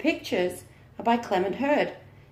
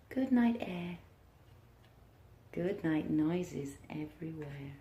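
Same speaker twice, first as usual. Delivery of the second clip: put-on reading to children; 3 semitones lower than usual. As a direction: lull the class toward nap time. Teacher reads picture book aloud.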